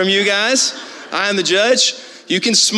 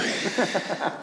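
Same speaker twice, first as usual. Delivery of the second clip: breathless laugh; 11 dB quieter than usual. Real, not acted. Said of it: "I'm out of breath".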